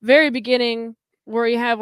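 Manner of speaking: normal talking voice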